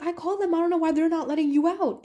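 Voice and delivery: high-pitched exasperated reply; retold exasperated mom. Mom telling daughter she already called, exasperated.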